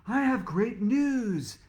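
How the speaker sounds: slow, high, loud proclamation; grand announcement. Acted. Inventor announcing sales to the class, slow proud high announcement. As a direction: announce it like big news. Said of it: "she announced".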